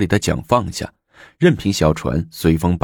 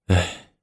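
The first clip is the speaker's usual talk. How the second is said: long sigh. A narrator's reading. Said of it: "李治长叹了口气说". (长叹一口气)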